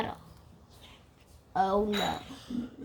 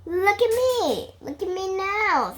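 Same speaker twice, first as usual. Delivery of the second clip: high, loud show-off call; high, loud look-at-me; boastful cat; 7 semitones higher, 7 dB louder than usual. Acted. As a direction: crow it like a proud cat. Cat showing off in story.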